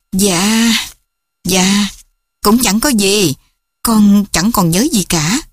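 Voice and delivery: mildly hesitant, embarrassed reply; a touch of awkward hesitation; flustered. A narrator's reading. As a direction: brush off the question awkwardly. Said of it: "Hồng lúng túng nói".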